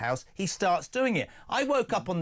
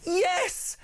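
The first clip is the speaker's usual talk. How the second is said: high-pitched, hammed-up jubilation; drawn-out, gleeful high cry of victory; triumphant cheer; 13 semitones higher than usual. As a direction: celebrate like your side just scored. Mock cheer at the retold news.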